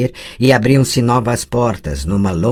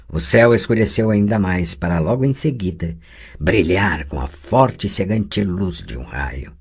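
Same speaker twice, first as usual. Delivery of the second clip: ordinary talk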